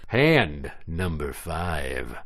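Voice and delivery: slow dramatic announcement; drawn-out game call; slow announcer. Acted.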